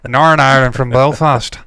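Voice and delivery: loud Belfast accent with a grill on it; Northern Irish accent. Shown as a demonstration. Showing co-host a Belfast voice, loud Northern Irish accent. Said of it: "a very good Northern Irish accent".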